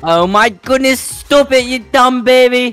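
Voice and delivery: put-on British teacher voice; British accent. Teacher to late pupil, scolding in British accent. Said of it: "I forgot you're British".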